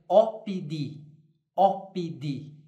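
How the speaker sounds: slow, deliberate opidi; slow Latin model. Shown as a demonstration. Teacher repeating opidi slowly to students. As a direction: spell out opidi for the class. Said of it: "como traduziria da cidade".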